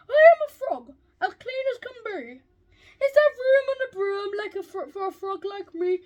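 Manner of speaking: high, loud, croaky frog voice; croaking frog. A narrator's reading. Frog asks the witch for a ride, croaking. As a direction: croak it out like an eager little frog. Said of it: "said with a croak".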